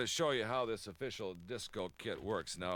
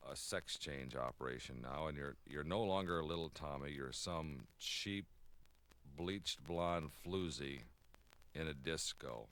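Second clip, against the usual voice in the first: hypnotist voice; 6 semitones lower, 5 dB quieter than usual. Pretend. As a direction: lull him under like a stage hypnotist. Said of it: "I'm gonna hypnotize you".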